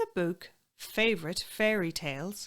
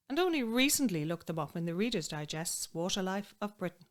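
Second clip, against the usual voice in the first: usual speaking voice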